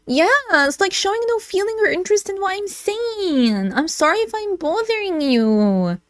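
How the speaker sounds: high, pitiful put-on whine; mock whining. Complaining partner guilt-trips Emily, mock whining. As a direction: whine it high and stretch the last word.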